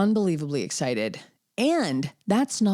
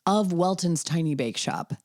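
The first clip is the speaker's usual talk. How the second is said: ordinary talk, nothing marked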